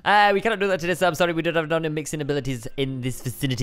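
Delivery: low, fast shopkeeper patter; speaking really fast. Shown as a demonstration. Shopkeeper hurrying an apology to customer, fast patter. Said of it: "Speak really fast".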